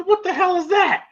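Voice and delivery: high, startled cry of disbelief; imitating Apollo's high, startled reaction.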